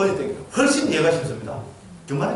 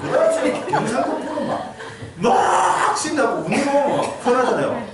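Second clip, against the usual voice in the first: slow, laughing, higher; laughing delight; 6 semitones higher, slower than usual. Real, not acted. Monk tells how Gyeongsang listeners enjoyed his dialect, laughing. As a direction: chuckle through it, savouring the story.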